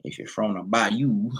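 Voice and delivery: slow, badly faked Bayou drawl; terrible Bayou accent. Shown as a demonstration. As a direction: attempt a Bayou accent and botch it. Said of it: "it's terrible Bayou accent".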